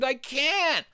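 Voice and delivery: put-on high, whiny child's protest; distressed refusal. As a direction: balk in a high, pleading voice. Girl balks at opening door.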